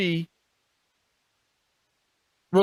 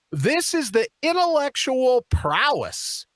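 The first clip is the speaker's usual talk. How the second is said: sarcastic; 6 semitones higher, slower than usual. Real, not acted. Sarcastic slow emphasis.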